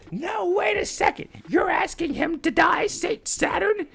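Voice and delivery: high, loud outraged shout; shouting, high and loud, as the scientist; furious Vegapunk. Acted. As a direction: rage at the elder for demanding his death. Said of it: "Vegapunk is heated".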